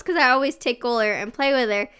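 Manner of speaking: ordinary delivery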